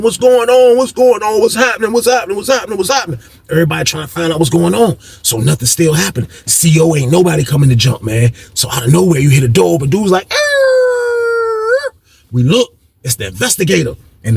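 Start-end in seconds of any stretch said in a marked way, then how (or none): 0.0-3.2 raised-pitch mock clamor, fading off; rapid repeated questions without real volume; imitated yelling. Locked-down inmates call for news, mock yelling. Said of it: "everybody's still yelling". 10.3-11.9 long, high, held buzz; imitated door buzzer. Inmate mimics the cell door opening, high buzz.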